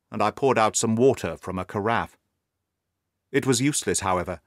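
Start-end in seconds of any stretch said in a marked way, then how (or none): none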